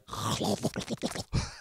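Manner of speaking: snore like water bubbling in a bong; imitated snoring. Imitating roommate's bubbling snore, snoring. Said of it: "that on top of snoring is like".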